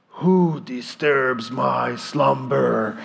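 slow, low booming cave of wonders; imitating a small boy doing a big deep voice; slow looming menace. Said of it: "lower as he can with his tone".

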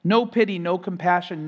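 ordinary talk

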